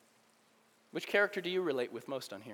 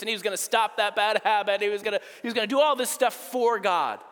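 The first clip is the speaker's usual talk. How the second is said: preaching build; 3 semitones higher, 5 dB louder than usual. Acted. Building sermon point.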